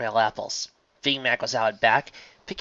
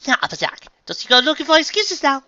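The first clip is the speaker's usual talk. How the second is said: stern; 8 dB louder than usual. A narrator's reading. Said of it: "gave a stern look".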